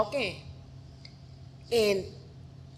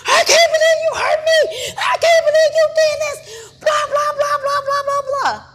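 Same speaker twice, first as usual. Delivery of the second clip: loud, high hammed-up wailing; mock crying; 11 dB louder than usual. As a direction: imitate a narcissist's tearful tirade. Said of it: "he crying".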